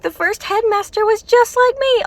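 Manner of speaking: high, starry-eyed schoolgirl; lightly voiced naive student, excited; mock girl voice. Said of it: "She's like".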